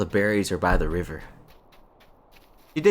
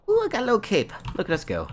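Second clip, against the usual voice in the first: delighted; 12 semitones higher, faster than usual. Real, not acted. Delighted at a new cape.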